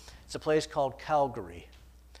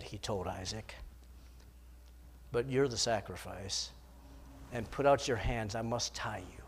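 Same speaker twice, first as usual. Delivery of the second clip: breaking it gently to his son; grieving father; 4 semitones lower than usual. Acted. Abraham binding his son.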